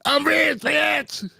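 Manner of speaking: Donnell impression. Comic Donnell boasting about being rich. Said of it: "And Donnell was like".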